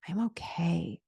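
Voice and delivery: whisper. Shown as a demonstration. Soothing self-talk demo.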